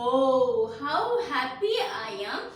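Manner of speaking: a touch of a happy little kite; joyful cry. Acted. Teacher voicing the little kite for children, mildly joyful. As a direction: call it out with mild delight. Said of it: "the little kite cried".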